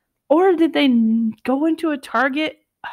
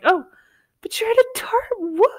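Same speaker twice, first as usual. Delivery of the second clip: baffled; 11 semitones higher than usual. Retold or imitated. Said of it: "you were like".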